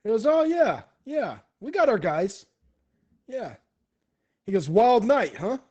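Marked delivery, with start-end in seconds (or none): 0.3-3.6 loud, high-pitched and boisterous; rowdy, upbeat yeah; hearty agreement. Acted. Kung Po's hearty reply. 4.7-5.6 loud, high-pitched exclamation; big, lively camaraderie; boisterous banter. Acted. Kung Po banters loudly.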